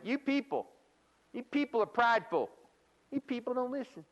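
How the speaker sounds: high-pitched mock indignation; mock accusation. Trainer acting out comments to avoid, high mock accusing voice. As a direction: scold a whole group in a put-on high voice. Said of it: "if you avoid the comment".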